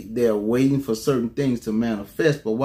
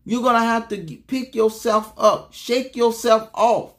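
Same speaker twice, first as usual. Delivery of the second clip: high, urgent preacher's exhortation; rally the congregation with raised pitch; impassioned pulpit push, driving the point home; fervent preaching; 7 semitones higher than usual. Real, not acted. Preaches with rising force.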